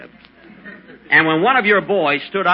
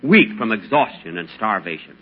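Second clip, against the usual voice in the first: dramatic story narration; 4 semitones lower than usual. Acted. Dramatic desert tale, music.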